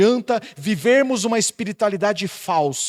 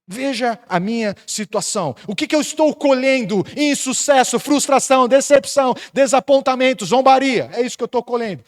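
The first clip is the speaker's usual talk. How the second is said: higher and louder, a lament hammered out word by word; preaching at full force; 8 semitones higher, 6 dB louder than usual. Acted. Pastor voicing Jeremiah's complaint to God, loud preaching lament. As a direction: cry out the list of failures to the church.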